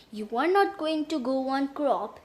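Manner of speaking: usual speaking voice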